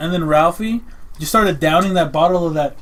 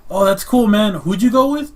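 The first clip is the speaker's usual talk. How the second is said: upbeat friend voice; 5 semitones higher, 5 dB louder than usual. Retold or imitated.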